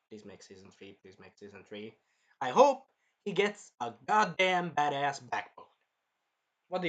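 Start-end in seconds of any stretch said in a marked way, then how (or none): 0.1-1.9 lower, softer begging please make season three; quiet plea. Real, not acted. Fan begging the studio for more, quiet plea. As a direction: plead softly, almost to yourself. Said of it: "pleading to the show makers".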